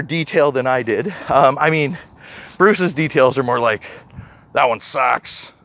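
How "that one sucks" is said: Bruce impression. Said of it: "your voice is much better than that, Bruce".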